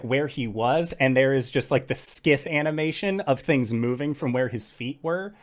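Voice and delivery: normal talking voice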